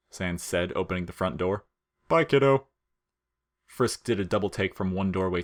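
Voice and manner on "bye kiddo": high, loud, quick farewell; Sans's breezy goodbye. Acted. Sans brushes the kid off with a cheery goodbye. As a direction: wave him off with a cheery bye.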